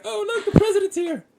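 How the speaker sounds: very high, put-on onlooker voice; mock excited onlookers. Host mimicking everybody spotting the president, high mock excitement.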